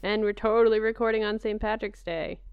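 usual speaking voice

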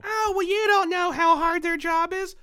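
high mocking sarcasm; mock their excuse in a put-on high voice; sneering pretend sympathy; sarcastic.

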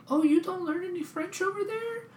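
very high-pitched mimicked disbelief; mock incredulous. French kids to an American in a bar, mock incredulous.

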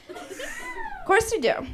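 very high, slow, squeaky take on Of course you do; a high cartoon squeak, slowed right down; squeaky drawn-out answer. Shown as a demonstration.

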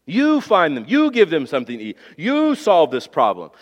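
each YOU stretched and stressed, then falling; emphatic you. Acted. Jesus charging the disciples to solve it, stress on each YOU. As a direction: hit YOU at the head of every sentence. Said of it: "is emphatic".

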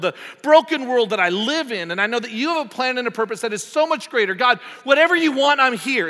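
loud, high, impassioned preaching; preaching at full force. Acted. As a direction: pour out the surrender prayer with fervour. Passionate prayer in a sermon.

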